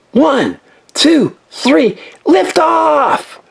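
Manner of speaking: high, very slow countdown call; launch countdown. Acted. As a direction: call out the lift-off.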